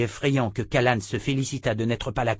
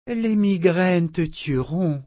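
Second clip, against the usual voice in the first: higher, slow grave warning; Grace warning; 8 semitones higher, 3 dB louder, slower than usual. A narrator's reading. Grace warns Richard, high, slow and grave. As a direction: let it land slowly.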